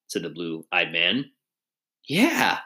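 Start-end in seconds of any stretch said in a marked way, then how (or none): 2.0-2.6 a quick pitched-up yeah; exclaimed. A narrator's reading. Short man cheering on recalled psalm, exclaiming higher. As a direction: blurt it out eagerly, higher. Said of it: "exclaimed the short man".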